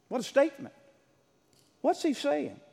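ordinary talk

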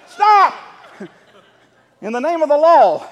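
loud and high mock lawman command with a laugh; hammed-up police shout. Pastor joking to the congregation in a mock police shout. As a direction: play the cop for laughs.

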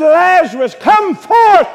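loud, high call to Lazarus; shouted command. Retold or imitated. Jesus at the grave calling Lazarus, shouting. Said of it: "He said".

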